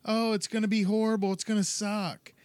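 high-pitched fretting; mock panic. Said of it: "freaking out".

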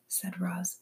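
ordinary delivery, nothing marked

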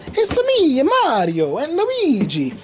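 high, swooping Italian cartoon accent; Mario's Italian accent. Fan quoting the movie's characters, mock Italian accent. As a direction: imitate the film's playful Italian accent. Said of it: "they do the accents".

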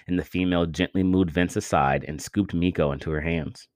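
usual speaking voice

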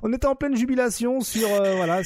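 bubbling over with amusement; excited high voice. Real, not acted. Laughing reply, very high.

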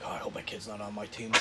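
whispered aside, as dad; hushed worried coach aside; mock whisper. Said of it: "he's like".

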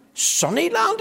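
high astonished "Sunnyland?"; can't believe the name; surprised question. Acted. Mister Sneeze surprised by the old wise man's answer.

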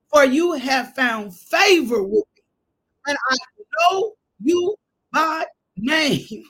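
preacher voicing God's answer to Moses; fervent preached promise. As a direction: proclaim it slowly with weight.

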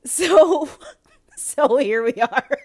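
high, loud words burst through laughter; laughing. Real, not acted. Podcaster resuming the show after cracking up, laughing. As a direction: try talking while still cracking up.

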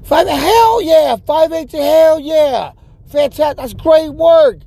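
mock hype. Praising the friend's count, mocking exaggerated excitement. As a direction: gush like it's a huge number.

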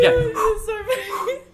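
cracking up mid-sentence; words squeezed out through laughter; laughing. Real, not acted. Laughing while starting story.

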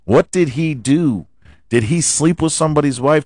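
ordinary talk

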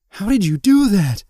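high, loud cry of total disbelief; incredulous shout. A narrator's reading. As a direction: shout it as if you can't believe your eyes. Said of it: "she shouted incredulously".

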